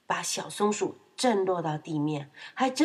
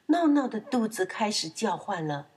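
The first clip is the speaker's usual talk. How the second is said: ordinary delivery, nothing marked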